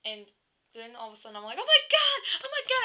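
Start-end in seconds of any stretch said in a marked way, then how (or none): 1.6-2.8 loud high-pitched squeal; full-volume reenacted fan scream; freaking out. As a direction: shriek it like a starstruck fan. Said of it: "I start freaking out smack".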